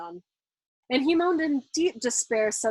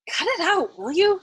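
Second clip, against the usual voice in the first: usual speaking voice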